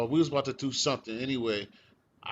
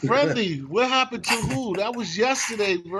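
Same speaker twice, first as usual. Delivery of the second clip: high, raised-voice disbelief; loud incredulous correction; 8 semitones higher, 6 dB louder than usual. Real, not acted. Co-host correcting a confused, just-woken Friendly, loud disbelief. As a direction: call out the co-host's mix-up, loud and high.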